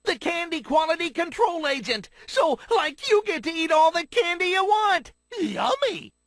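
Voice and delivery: loud, thrilled shout; gleeful shout about eating all the candy; excited. Acted. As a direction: blurt it out overjoyed at free candy.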